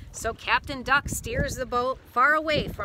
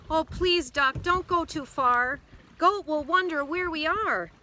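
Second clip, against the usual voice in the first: higher, pleading storybook voice; worried pleading; 6 semitones higher, 3 dB louder than usual. A narrator's reading. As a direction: beg Duck to turn back. Sheep pleads with Duck to stop.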